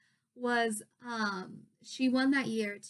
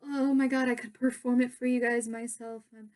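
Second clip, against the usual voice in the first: loud, tumbling fan gush, re-enacted; starstruck babbling; 3 semitones higher, 6 dB louder than usual. Fan begging two stars for a picture, starstruck. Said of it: "I was so starstruck".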